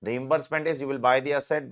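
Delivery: usual speaking voice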